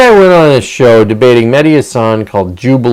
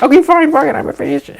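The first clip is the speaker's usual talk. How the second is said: mock bratty teen; faster than usual. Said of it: "it's like".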